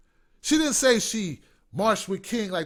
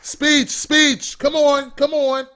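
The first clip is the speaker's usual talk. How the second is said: loud, high mock cheering; calling for speech; 8 semitones higher, 8 dB louder, much slower than usual. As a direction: egg on the winner like a rowdy audience.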